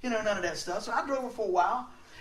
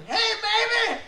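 high, loud catcall from a moving car; big comic catcall yell; yelling at girls; 13 semitones higher, 6 dB louder, slower than usual. Retold or imitated. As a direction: holler it out at the girls. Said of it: "let's go yell at the girls".

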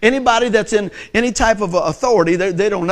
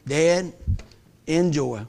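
ordinary talk

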